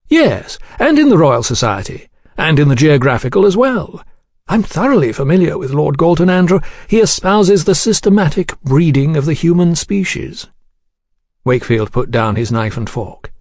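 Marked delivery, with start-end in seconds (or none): 0.1-10.5 higher, courteous gentleman's voice; reply smoothly in a raised, polished voice; composed suave gentleman. Acted. Gentleman telling the diner he knows the lord, coolly courteous.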